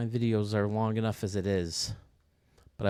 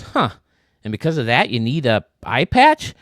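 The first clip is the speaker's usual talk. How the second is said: louder, puzzled questioning; puzzled interviewer; 11 dB louder than usual. Retold or imitated. Interviewer puzzled by the pirate's story, louder and baffled. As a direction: ask it baffled. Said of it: "The guy goes".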